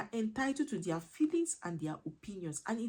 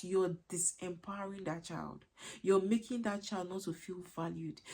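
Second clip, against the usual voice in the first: ordinary delivery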